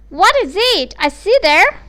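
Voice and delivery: high, loud astonished mother; a mother who can't believe her eyes. Acted. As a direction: gasp it out in amazement. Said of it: "quite astonished".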